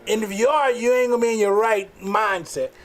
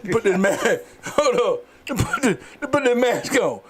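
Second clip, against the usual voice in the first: put-on breathless old person; 3 semitones higher than usual. Gasping old man wants his mask.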